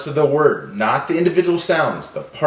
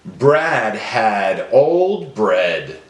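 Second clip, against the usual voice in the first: slow, lower, carefully spaced words; 4 semitones lower, slower than usual. Shown as a demonstration. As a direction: let each final D be heard. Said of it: "they all end with the same sound".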